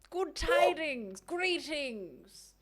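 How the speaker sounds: slow, grand mock-formal greeting; fancy greeting. Shown as a demonstration. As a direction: greet them like a lightly hammed-up noble. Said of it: "but it sounded fancy".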